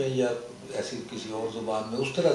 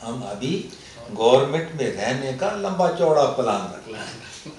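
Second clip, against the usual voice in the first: Urdu ghazal line with Pashto colouring; Pashto accent; 5 dB louder, slower than usual. Acted. Poet teasing government men at a mushaira, Pashto accent. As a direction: recite it comically in a Pashto accent. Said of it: "میں پڑھتا بھی اسی لہجے میں تھا".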